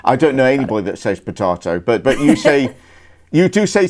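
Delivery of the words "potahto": "potato" with a long AH middle, puh-TAH-toh; rejected potato. Shown as a demonstration. Cohost doubting anyone uses it, potato as puh-TAH-toh.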